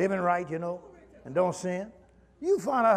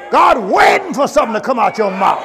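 preaching at full force; 7 dB louder than usual. Acted. Preacher urging the church, at full force. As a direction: drive the exhortation home like a pulpit call.

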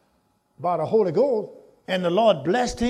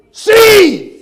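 one word, much higher and louder; shouted key word; 14 semitones higher, 16 dB louder than usual. Real, not acted. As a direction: fling the word out to the congregation. Preacher answering his own question.

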